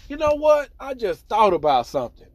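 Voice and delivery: high, loud sudden realization; excited realization. Retold or imitated. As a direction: blurt it out, suddenly remembering. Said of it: "he was like".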